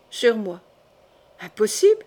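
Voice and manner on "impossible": higher, louder questioning cry; startled disbelief. Acted. Sister to her brother on hearing of the crime, startled disbelief. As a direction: let the question leap up in shock.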